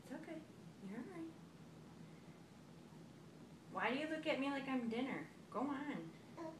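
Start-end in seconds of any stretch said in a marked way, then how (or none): 0.0-1.3 soft, low reassuring words. Real, not acted. Comforting wobbling toddler.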